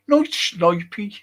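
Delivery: amused Brummie impression; Brummie accent. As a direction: draw it out in a Birmingham accent. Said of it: "that's a, a Brummie accent".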